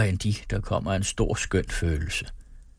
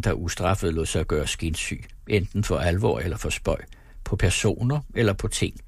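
usual speaking voice

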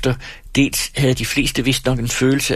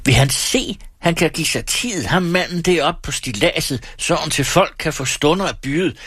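higher pitched, furious outburst at the auctioneer; bitter shouting; 8 semitones higher than usual. A narrator's reading. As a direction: rail at the whole crowd in bitter anger. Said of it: "råbte Erik Skintoft aldeles forbitret".

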